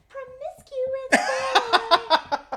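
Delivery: high, slow sung line; playful high singing of the girl's part. Retold or imitated. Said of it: "And then the girl's like".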